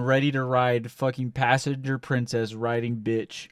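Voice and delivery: ordinary talk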